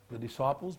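normal talking voice

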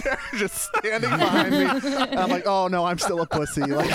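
laughing. Real, not acted. Telling friends about his backup behind him, laughing. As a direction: crack up at your own punchline.

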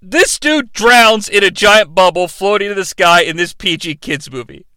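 ordinary talk, nothing marked

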